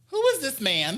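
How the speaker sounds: high, incredulous question; tipsy disbelief. Retold or imitated. Drunk singer questioning a stranger's approach, high incredulous voice. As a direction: ask it high-pitched, as if baffled.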